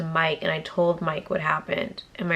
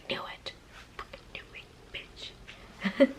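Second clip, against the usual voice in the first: slow, hushed whisper; whispered retort; 7 semitones higher, 12 dB quieter, slower than usual. Retold or imitated. Telling a friend she knew about the crashed car, whispered. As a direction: whisper it slowly, savouring being right. Mock reply to friend's confession.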